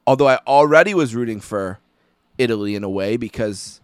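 ordinary talk

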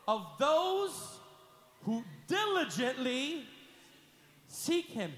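slow, weighted reading; slowly. Shown as a demonstration. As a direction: draw each word out. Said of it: "read this slowly".

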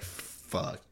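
hushed, voiceless swear of dread; retold whispered "oh no" moment; whispered curse. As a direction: let it out under your breath. Retold sinking realization of guilt.

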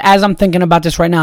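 ordinary talk

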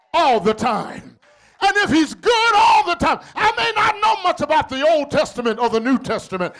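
high, impassioned shouted sermon; preaching at full force. Real, not acted. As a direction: drive each phrase toward a stretched, shouted climax.

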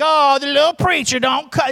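mock-pitying jeer; mocking bully. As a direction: tease like a schoolyard bully. Imitates mocking classmates.

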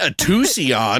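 "Tucson" echoed slowly as too-see-on; "Tucson" with three syllables, querying it; mimicked mispronunciation. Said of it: "you couldn't pronounce it?".

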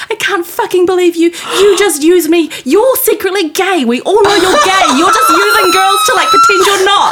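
put-on angry drunk yell. Retelling the girl outside the pub, furious high shout.

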